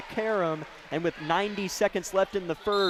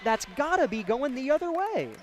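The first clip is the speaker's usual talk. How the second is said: emotional outburst; 6 semitones higher than usual. Real, not acted. Commentator disputing a foul call, emotional and high-pitched. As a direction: pitch climbing high in protest. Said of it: "I'm getting a little emotional".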